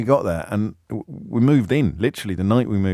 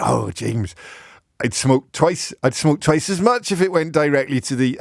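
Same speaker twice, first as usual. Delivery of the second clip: a light Norfolk burr, a little higher; mock Norfolk Bob; 5 semitones higher than usual. Host contrasting Bob's Norfolk voice with the Devon seller, light mock accent. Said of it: "Bob from Ely said".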